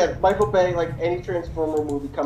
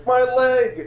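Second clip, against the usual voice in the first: high, drawn-out mock cry; imitated shout; 8 semitones higher, 4 dB louder than usual. Imitating audience member during movie, high mock shout. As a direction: yell it like a heckler in the cinema. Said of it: "and shouted".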